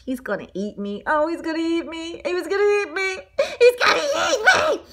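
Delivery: hammed-up escalating terror, pitch climbing each time; mounting panic. Donut panics about being eaten.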